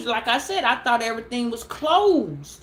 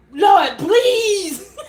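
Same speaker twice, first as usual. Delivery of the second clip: high laughing plea, louder; laughing mock prayer; 7 semitones higher, 6 dB louder than usual. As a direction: beg the Lord through laughter. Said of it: "She wasn't like".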